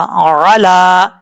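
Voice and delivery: अला with a clear ع and a long ला; recited slowly. Shown as a demonstration. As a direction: draw out अला from the throat. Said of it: "बस इस तरीके से पढ़ना है". (धीमी तिलावत में अला)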